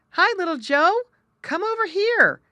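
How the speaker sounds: high, loud call across the water; shouting. Acted. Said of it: "shouted Jerry".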